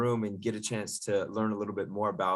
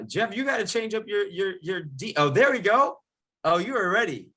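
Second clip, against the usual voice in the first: excited; 10 semitones higher, 3 dB louder than usual. Real, not acted. Host teasing student's name change.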